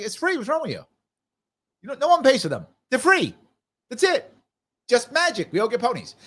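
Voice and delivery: high-pitched, exasperated mock insistence; Vermin Supreme, straight-faced insistence. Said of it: "he said it with a straight face".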